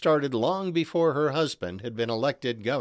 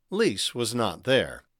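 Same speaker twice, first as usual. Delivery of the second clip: ordinary delivery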